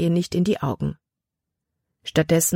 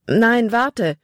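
higher, urgent call after him; 6 semitones higher, 4 dB louder than usual. Acted. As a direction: call him back before he goes. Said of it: "wollte Libby rufen".

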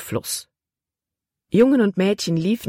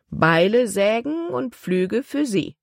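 higher, drawn-out list; calling back; 5 semitones higher, slower than usual. A narrator's reading. Ship's boy listing tools to the crowd, calling back. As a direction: stretch out each item for the crowd.

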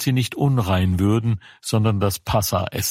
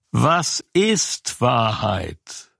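sceptical musing; 2 semitones higher, much slower than usual. Acted. Pilate's sceptical question.

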